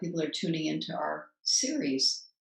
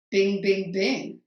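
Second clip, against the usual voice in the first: louder, ringing "bing, bing, bing"; mimicked phone chime; 3 semitones higher, 6 dB louder, slower than usual. Host answering her interrupting phone, mimicked chime. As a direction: echo the phone's ding back at it.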